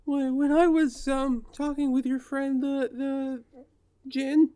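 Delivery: stammering high anxious voice. Acted.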